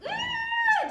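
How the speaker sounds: very high, loud squeal of frustration; frustrated scream. Retold or imitated. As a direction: let out a loud exasperated shriek. Frustrated parent wants to scream.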